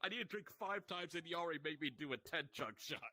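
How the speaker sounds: ordinary delivery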